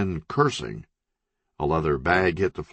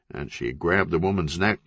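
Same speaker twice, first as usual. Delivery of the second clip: ordinary delivery, nothing marked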